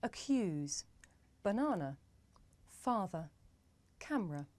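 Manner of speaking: Accuse, banana, father, camera with unstressed uh; schwa examples. Shown as a demonstration. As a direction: model each word with a light schwa. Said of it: "Let's have a look at some examples".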